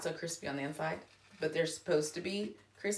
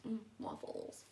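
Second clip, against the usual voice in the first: dreamy whispered craving; 4 semitones higher, 14 dB quieter than usual. Real, not acted. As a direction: whisper it longingly.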